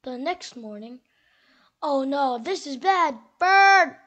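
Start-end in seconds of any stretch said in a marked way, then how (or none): 1.8-4.0 loud alarmed cry building to a yell; panic, then shout for help; alarmist doom warning. Acted. Squirrel alarmed, calls Bird.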